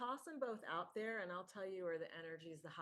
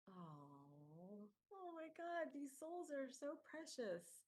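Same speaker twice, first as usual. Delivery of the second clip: higher, slow, breathless wonder; awed gasp; 7 semitones higher, 4 dB quieter, much slower than usual. Acted. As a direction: marvel at the precious souls, slowly. Spirit overwhelmed by the souls.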